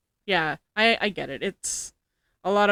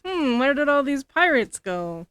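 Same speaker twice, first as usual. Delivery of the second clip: higher, musing pretend confusion; mock puzzlement; 7 semitones higher, 5 dB louder than usual. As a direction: wonder aloud, playing innocent. Acting out a puzzled player.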